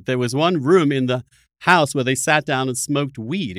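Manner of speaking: ordinary talk, nothing marked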